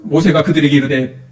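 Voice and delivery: ordinary talk, nothing marked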